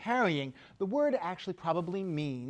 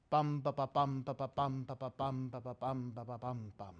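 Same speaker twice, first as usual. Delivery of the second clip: beating out the meter, fading softer; rhythm beats; 3 semitones lower, 5 dB quieter than usual. Shown as a demonstration. Teacher chanting the dactylic rhythm to students, fading. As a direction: tap out bum ba ba as a fading beat. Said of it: "You may have heard the rhythm there".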